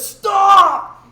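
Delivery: high, very loud drawn-out holler; imitated manager's full-voiced yell; shouted command. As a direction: shout to halt a car. Manager jumps before the car.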